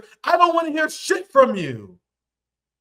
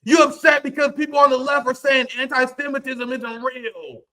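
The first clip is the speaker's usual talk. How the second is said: usual speaking voice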